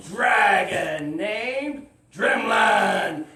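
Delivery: slow, booming shout of the name; big dramatic reveal. Acted. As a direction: burst out, long and loud.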